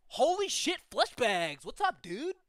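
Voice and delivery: excited surfer-dude demon, high and loud; big, loud dude greeting, pitch leaping up; Crush from Finding Nemo. Acted. Giant demon hailing visitors to hell, excited surfer dude. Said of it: "sound like Crush from Finding Nemo".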